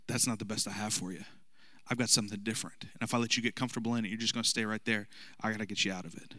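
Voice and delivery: soft, tender voice of God; God speaking. Retold or imitated. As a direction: speak gently, like a loving father. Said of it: "God says".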